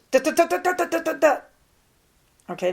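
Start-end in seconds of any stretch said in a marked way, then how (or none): 0.1-1.4 high, rapid-fire run of clipped "da" syllables; escalation sound. Shown as a demonstration. Teacher imitating how escalating people sound, short high rapid syllables. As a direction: fire off short, high, louder da-da-das, evenly hammered. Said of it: "they sound like this".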